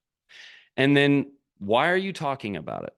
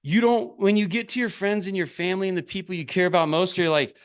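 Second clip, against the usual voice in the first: usual speaking voice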